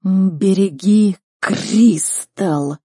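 extremely slow, loud beast warning; drawn-out creature command; snow leopard. Acted. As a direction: stretch each word like a talking predator. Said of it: "произнёс Барс".

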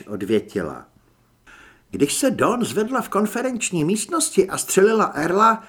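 usual speaking voice

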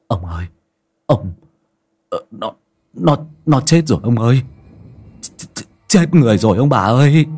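stammering, rising into alarm; frightened stammer. Acted. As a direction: stutter out the news in shock. Servant reports boy dead.

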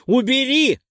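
high, loud one-word scream; desperate scream. Acted. Dex screams at Perk one last time, desperate scream. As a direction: scream it with all you have. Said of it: "прокричал тот".

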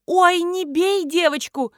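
high, loud, drawn-out cry of alarm; panicked plea. A narrator's reading. Nina begging Marina not to hit the pig, panicked plea. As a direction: plead in panic, stretching the words. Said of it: "запаниковала Нина".

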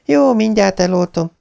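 high, quick promise to put it out; hasty wife. Acted. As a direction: placate him fast. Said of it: "felelte Beverly".

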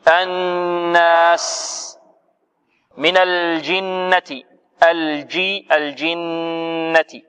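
very slow chanted verse ending, nasal held; Quranic recitation. Shown as a demonstration. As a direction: recite the close of the sura slowly. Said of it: "recitation demo".

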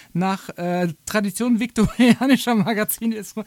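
ordinary talk

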